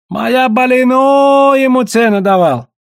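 high, loud, drawn-out cry; protesting. A narrator's reading. Trader insisting he offered a price, protesting. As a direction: stretch the boast into a wailing protest.

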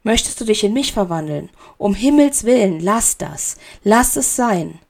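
ordinary delivery

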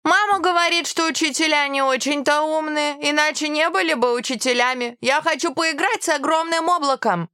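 put-on high-pitched petulant kid; whining little boy. A narrator's reading. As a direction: complain like a sulky pupil wanting to play. Upset boy protests to teacher.

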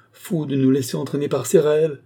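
high, urgent self-reproach; anguished cry. A narrator's reading. Woman breaking away from the marquis, crying out. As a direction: cry out against the dream. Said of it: "s'écria-t-elle".

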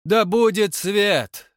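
very slow, loud, high proclamation; grandiloquent. Acted. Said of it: "высокопарно сказал кто-то".

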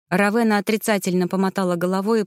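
plain reading voice

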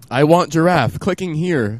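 mock childish demand; childish want. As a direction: beg for the toy like a child. Mock greedy kid wanting giraffe.

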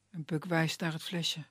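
ordinary delivery

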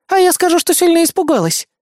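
high, stubborn retort; defiant comeback. A narrator's reading. Woman counters with a false excuse.

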